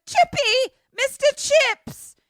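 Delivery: high, loud wail of a name; mock anguished call. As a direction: call out dramatically for a lost dog. Retold calling out for the dog.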